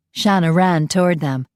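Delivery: usual speaking voice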